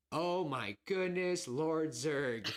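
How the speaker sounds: stretched-out theatrical disbelief; shocked excitement. Retold or imitated. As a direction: relive the big twist reveal. Reliving the twist in the theater.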